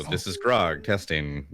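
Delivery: normal talking voice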